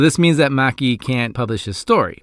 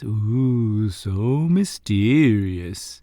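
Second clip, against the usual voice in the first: drawn-out, spooky mock intrigue; mock mysterious; much slower than usual. Reviewer teasing a dramatic close-up of the actor's eyes, mock mysterious. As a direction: stretch it out slowly in hammed-up wonder.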